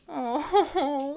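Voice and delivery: usual speaking voice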